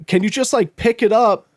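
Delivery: high, mild mock holler; retold yell. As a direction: imitate a called-out request. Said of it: "he's like, he's yelling".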